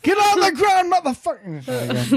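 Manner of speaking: high, then dragged-out "motherfucker"; a cartoon cop tackling a suspect; mock cop bark. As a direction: yell the arrest like a hammy cop. Imagined cop arrest.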